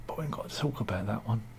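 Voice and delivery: fast conspiratorial whisper. Real, not acted. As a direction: whisper it as a secret aside. Conspiratorial aside on scandal.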